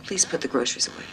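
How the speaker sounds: soft, low and drained after a row; quietly restrained request; subdued parting. Acted. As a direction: drop your voice and leave the quarrel behind. Mother leaving after quarrel with daughter.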